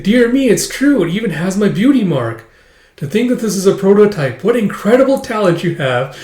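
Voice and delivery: high, lilting voice of admiration; doting maid, amazed. Acted. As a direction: gush politely like an impressed maid.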